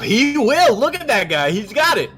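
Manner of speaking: high, loud and insistent; heated in-character argument; exasperated shouting. Acted. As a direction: yell over his protests. Player shouts down protesting man.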